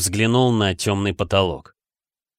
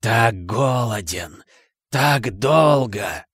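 very slow, eerie hungry creature; icy murderous voice; much slower than usual. A narrator's reading. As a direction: stretch the words out with cold menace. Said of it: "Снова этот голос". (ледяной голос, медленно и протяжно)

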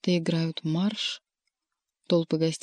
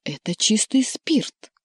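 louder, higher croak of indignation; hoarse, offended cat; 3 semitones higher, 5 dB louder than usual. A narrator's reading. Offended cat defending his drink to Margarita, hoarse and indignant. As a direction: protest hoarsely, stretching out the last words. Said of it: "прохрипел он".

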